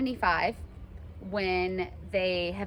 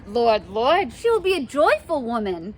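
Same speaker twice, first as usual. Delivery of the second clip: high, loud and delighted; thrilled nurse exclaiming to Romeo; excited nurse; 4 semitones higher, 6 dB louder than usual. Acted. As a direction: gush like an overjoyed old nurse. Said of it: "she's so excited".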